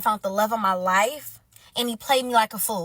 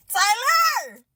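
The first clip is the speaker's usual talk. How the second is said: high, loud holler; hammed-up shout; mock calling out; 9 semitones higher, 5 dB louder than usual. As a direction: holler the name as if summoning someone. Calls out to Tyler Perry.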